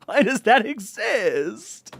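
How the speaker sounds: high, slow, incredulous wail; exasperated disbelief. Real, not acted. Reader asking why that wiki exists, exasperated disbelief. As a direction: stretch out the appalled question.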